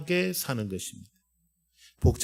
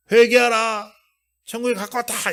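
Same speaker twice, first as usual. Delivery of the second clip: loud, high fiery call to repent; fervent shout; 9 semitones higher, 8 dB louder than usual. Shown as a demonstration. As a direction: cry it out like a real prophet. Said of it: "이게 아니고 그냥".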